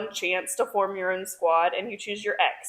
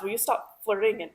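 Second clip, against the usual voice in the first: higher, fast, fed-up; exasperated Riddick; 6 semitones higher, 7 dB louder, faster than usual. Retold or imitated. Ridoc groaning at flirting, fast and higher. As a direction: hurry it out in exasperation. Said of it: "And Riddick goes".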